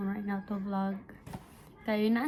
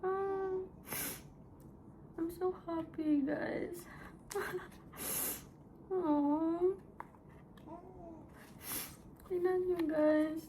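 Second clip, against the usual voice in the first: high, tearful voice; weepy, overwhelmed with love; crying with happiness; 5 semitones higher, 6 dB quieter, slower than usual. Real, not acted. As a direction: sob softly through the joy. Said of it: "I don't know why I'm crying".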